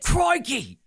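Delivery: high shouted Aussie crikey; Australian accent. Pretend. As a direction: belt it like a croc hunter. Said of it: "[name] pretending to be Australian".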